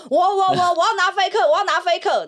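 high, eager voices calling dibs; eager grabbing. Retold or imitated. Players clamoring to grab the Faker sign, eager and high.

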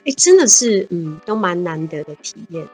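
ordinary delivery

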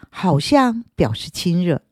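usual speaking voice

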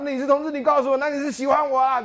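high, loud startled question; a straight friend recoiling at the news, high and loud; alarmed friend. Retold or imitated. Straight friend reacting to a coming-out, high loud alarm. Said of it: "很多人就想说".

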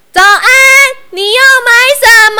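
high, loud and fast shopkeeper call; loud greeting. A narrator's reading. Shop clerk booms a greeting at the customer, loud. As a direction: blast the greeting across the counter. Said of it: "店员大声地问他".

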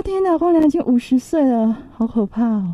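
shocked gasp. Retold or imitated. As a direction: act out the shock at his age. Reenacts her shocked reaction. (惊呼)

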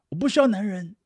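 high, loud mock declaration; mock defiance. Mocking independent claim. (高声模仿逞强说不需要男人)

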